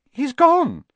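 high, sharp gasp of alarm; startled. Acted. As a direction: blurt it out, stunned. Harry alarmed at vanished picture.

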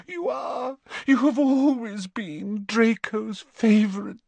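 high, trembling plea of a desperate mother; pleading Narcissa. A narrator's reading. Mother begging Snape to speak to the Dark Lord, high and desperate.

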